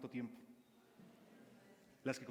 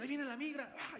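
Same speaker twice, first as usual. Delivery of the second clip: high startled alarm then a yelp; mock fright; 11 semitones higher than usual. Preacher acting out panic at the migra arriving, mock startled cry. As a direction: jump as if the migra just showed up.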